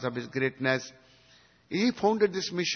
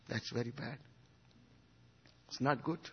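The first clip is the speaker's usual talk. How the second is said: normal talking voice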